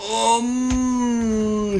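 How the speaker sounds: long high alarmed um; alarmed drawn-out um. Real, not acted. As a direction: hold a long panicked um. Sudden alarm, health very low.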